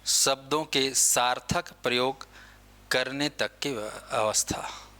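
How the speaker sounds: slow, word by word, for students to write; धीमे-धीमे लिखवाना; slow dictation. Shown as a demonstration. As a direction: spell it out slowly into their notebooks. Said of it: "teacher repeats dictation".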